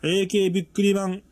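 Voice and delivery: エーケービックリマン read in deliberate separate pieces; sounding out words. Shown as a demonstration. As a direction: keep エーケービックリマン broken up, never one flow. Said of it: "連続読みやばいでしょ".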